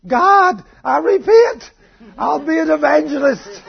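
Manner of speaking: high, loud hammed-up plea to God; desperate mock cry. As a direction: shout it out in comic desperation. Dying man's desperate prayer acted out.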